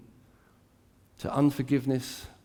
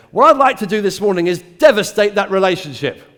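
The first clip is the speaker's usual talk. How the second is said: louder, higher mock tempter announcing his plan; mock devil voice; 5 semitones higher, 12 dB louder than usual. Preacher mocking the devil's blunt plan, louder. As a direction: ham up the blunt enemy. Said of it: "He doesn't come into your mind and say".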